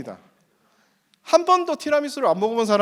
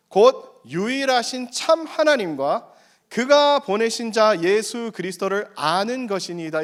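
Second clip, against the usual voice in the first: slow scripture reading; 3 semitones higher, slower than usual. Acted.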